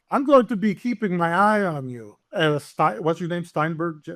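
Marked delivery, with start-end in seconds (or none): 0.1-2.2 higher-pitched, lightly voiced officer; German officer. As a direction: give a hint of a menacing soldier. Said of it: "He's like".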